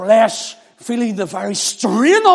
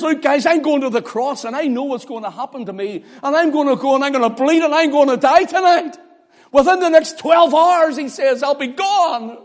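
urgent telling; 8 semitones higher, 7 dB louder than usual. Acted. Jesus telling disciples his fate.

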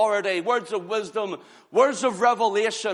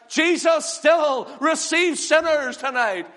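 preaching climax; 6 semitones higher, 4 dB louder than usual. Acted.

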